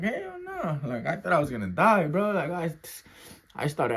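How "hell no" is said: very high, softer squeak; high-pitched refusal. Retold or imitated. Inmate refusing another round of spray, retold high squeak.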